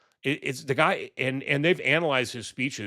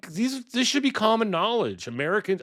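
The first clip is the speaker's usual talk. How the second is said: incredulous rant; 10 semitones higher than usual. Real, not acted. Ranting about common knowledge.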